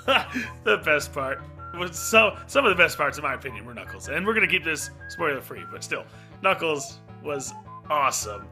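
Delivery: ordinary talk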